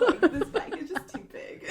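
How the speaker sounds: laughing mock excuse; fake confidence. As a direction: brush it off with a laugh. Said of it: "turning everything into a compliment".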